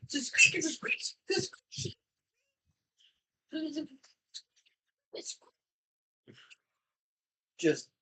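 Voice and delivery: high squawky parrot-like repeating. Retold or imitated. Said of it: "turned you into a parrot".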